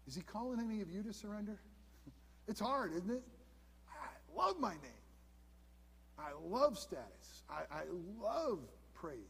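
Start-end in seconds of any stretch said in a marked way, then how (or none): 3.9-9.2 mock self-indulgent confession.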